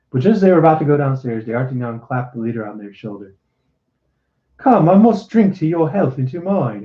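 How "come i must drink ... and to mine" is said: jovial. A narrator's reading. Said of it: "he said jovially".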